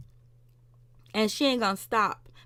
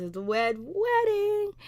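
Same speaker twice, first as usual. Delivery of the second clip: much higher and slow, like an ominous trailer voice; dramatic announcement; 12 semitones higher, slower than usual. Retold or imitated. Recapping the show to viewers, a mock dramatic high announcement.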